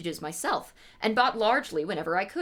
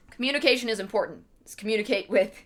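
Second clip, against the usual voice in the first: ordinary delivery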